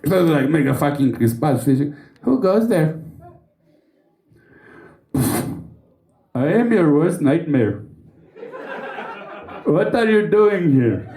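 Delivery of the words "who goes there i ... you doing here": slow, mock-menacing Indian accent; lightly put-on Indian accent, trying to sound threatening. Indian Batman confronting drug thieves, mock Indian accent. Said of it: "cum poți să fii amenințător cu un accent indian".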